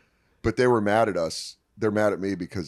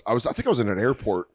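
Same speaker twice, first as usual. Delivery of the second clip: normal talking voice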